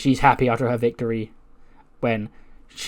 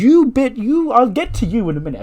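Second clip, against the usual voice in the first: high, loud, spluttered rebuke; acted exasperated anger; 11 semitones higher, 5 dB louder than usual. Becky turns on interfering Mackie, high exasperated outburst. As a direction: burst out high, tripping over the insult.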